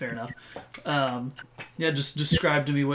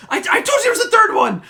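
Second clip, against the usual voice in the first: high, loud terrified yell; panicked scream; 10 dB louder, faster than usual. Acted. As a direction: shriek it in sheer panic. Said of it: "freaking out, I jump back".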